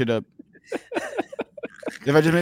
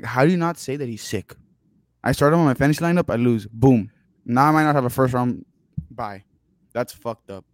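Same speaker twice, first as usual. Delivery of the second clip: ordinary delivery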